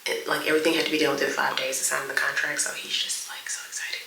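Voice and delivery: hushed, half-whispered update; whispering. Real, not acted. Vlogger updating viewers while someone is on the phone, whispering. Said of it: "that's why I'm whispering".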